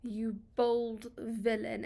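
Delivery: slow, drawn-out mock insult; lightly hammed-up scornful jab. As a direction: sneer at the sun. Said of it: "There's this mocking tone".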